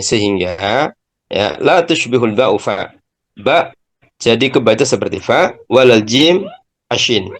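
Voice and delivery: ordinary talk, nothing marked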